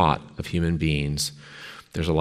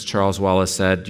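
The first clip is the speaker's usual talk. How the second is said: ordinary talk, nothing marked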